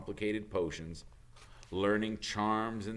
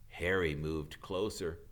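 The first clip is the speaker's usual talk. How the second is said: ordinary delivery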